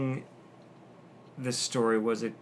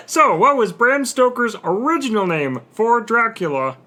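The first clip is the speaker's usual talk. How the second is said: announcer voice; 10 semitones higher, 8 dB louder than usual. Acted. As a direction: boom it like a game show host. Rereads question in announcer voice.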